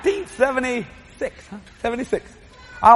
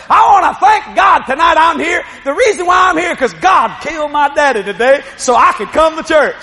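high, loud shouted church testimony; excited testimony; 7 semitones higher, 6 dB louder than usual. Retold or imitated. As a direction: jump up and shout your praise. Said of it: "She jumps up and said".

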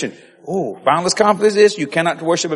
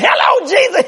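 high, loud acted morning hello; exuberant greeting; 14 semitones higher, 6 dB louder than usual. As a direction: call up to heaven, high and loud. Acts out morning greeting to God.

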